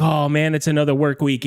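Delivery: low, deflated grumble; glum Monday voice. Retold or imitated. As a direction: sigh through the start of the week. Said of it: "you're like".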